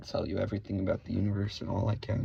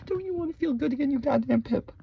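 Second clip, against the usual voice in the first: much higher, fast put-on squeal; a hammed-up high cartoon voice; mock squeaky voice; 4 dB louder than usual. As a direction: squeal it in a silly falsetto.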